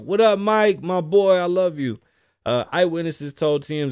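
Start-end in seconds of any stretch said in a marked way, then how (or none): 0.0-2.0 higher, louder greeting; mock fan shout. Fan greets Mike Tyson, higher louder mock shout. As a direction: hail him like a fan.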